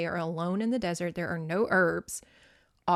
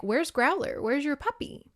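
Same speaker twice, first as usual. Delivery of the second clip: lightly acted dad asking his child; higher-pitched, questioning mock-dad voice; concerned father; 6 semitones higher than usual.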